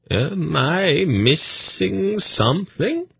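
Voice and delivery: floating, innocent curiosity; lightly voiced dreamy eunuch asking; curious eunuch. A narrator's reading. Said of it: "asked curiously".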